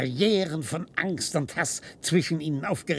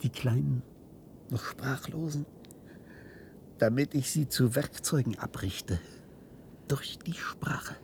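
horrified whisper; 6 dB quieter than usual. Acted. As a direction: confess it under the breath. Horrifying plan confessed.

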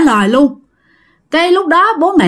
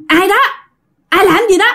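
calling out; 9 semitones higher, faster than usual. Retold or imitated. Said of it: "nghe tiếng ổng hỏi".

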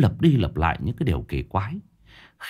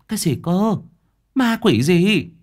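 higher, startled disbelief; shocked woman; 9 semitones higher, 3 dB louder than usual. A narrator's reading. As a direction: react aghast, pitch jumping up. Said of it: "Người phụ nữ kia cả kinh".

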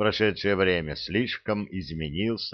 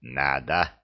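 low, smug Надо with a grin; gloating; 6 semitones lower than usual. Acted. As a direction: growl Надо with a pleased grin. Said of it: "довольно осклабился Урукхай".

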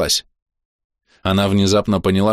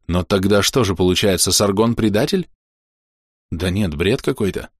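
usual speaking voice

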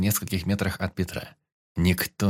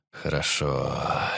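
long, slow and low; drawn-out; 6 semitones lower, much slower than usual. A narrator's reading. Пётр выпивает самогон и протяжно тянет слово. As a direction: stretch the word out, savouring the drink. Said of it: "произнёс протяжно".

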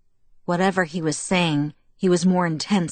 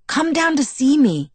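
high, eager plea; begging; 9 semitones higher, 4 dB louder than usual. A narrator's reading. Jacob invites Bella to visit, begging. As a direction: beg her to come over. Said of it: "he begged".

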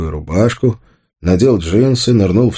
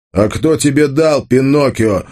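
raised, snapping challenge; sudden bark; 7 semitones higher than usual. A narrator's reading. Tolik suddenly challenges Arsen about Pinocchio, barking. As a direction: bark the question with mild force. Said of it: "вдруг рявкнул Толик".

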